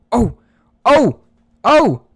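sudden loud excited oh; excited shouts. Real, not acted. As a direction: yell out in excitement. Tense battle moment, shouting.

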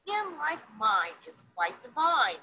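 lively picture-book read-aloud; reading to children. A narrator's reading. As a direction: read it to a classroom of kids, bright and sing-song.

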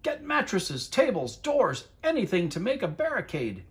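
high, urgent commands; mayor giving orders. A narrator's reading. The mayor orders a barricade, urgent high calls. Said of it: "shouted the mayor".

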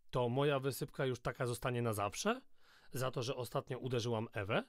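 plain reading voice

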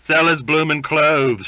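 high, loud shout from the crowd. A narrator's reading. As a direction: shout it out as a taunt. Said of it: "shouted another".

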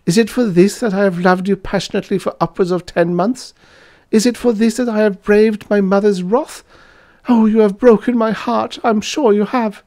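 put-on very high, wailing young lady; melodramatic, high-pitched hysterics; hysterical sobbing lament. A narrator's reading. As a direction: wail heartbroken reproaches at your lover. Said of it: "And she sobbed hysterically".

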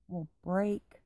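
usual speaking voice

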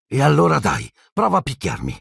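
put-on high boyish dare; defiant exclamation. A narrator's reading. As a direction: dare them, starting high and falling. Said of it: "esclamò Neville alzando i pugni". (esclamazione di sfida, voce acuta)

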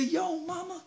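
crooned way up high and soft; hammed-up high pickup line. Paris sweet-talking Helen again, high crooning. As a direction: croon it like a smooth player.

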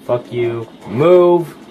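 loud, high angry shout; angry yelling. Real, not acted. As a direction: bark at the enemy. Yelling at game enemy.

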